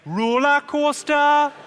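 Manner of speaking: high, loud broad Geordie chant; Geordie accent, all together. Shown as a demonstration. Comic leading the crowd in Geordie accent. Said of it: "all together".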